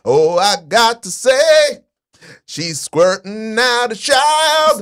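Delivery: slow, grand mock announcer; drawn-out pompous proclamation; mock royal announcement.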